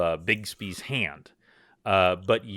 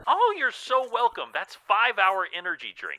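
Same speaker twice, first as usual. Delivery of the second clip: high-pitched, eager wizard voice; obliging wizard plugging his potion; gracious famous wizard; 11 semitones higher, faster than usual. Acted. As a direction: pitch it up and gush like a friendly salesman.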